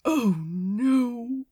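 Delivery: dismayed, dawning horror; drawn-out dread. A narrator's reading. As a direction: say it aghast. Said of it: "said Aziraphale in horror".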